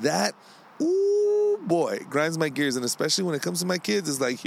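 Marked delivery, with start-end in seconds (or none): 0.8-1.6 high squeal. Acted. Exasperated outburst mid-rant.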